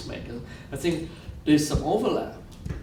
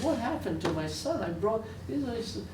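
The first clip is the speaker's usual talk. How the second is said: higher, imitating a grieving father's broken English; 9 semitones higher, 3 dB quieter than usual. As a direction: ask it as the broken-hearted father in his broken English, pitched higher. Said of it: "I love his broken English".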